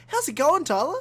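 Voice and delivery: chirpy casual hello; cheery Aussie rep. Retold or imitated. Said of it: "it's just like".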